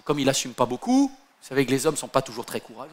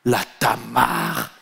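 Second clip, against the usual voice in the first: gossipy drawn-out name; 5 semitones lower, much slower than usual. Acted.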